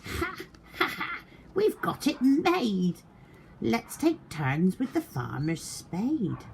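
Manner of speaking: high hammed-up thief cackle; mock gleeful laugh. A narrator's reading. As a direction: laugh like a greedy crook. Thieves laughed.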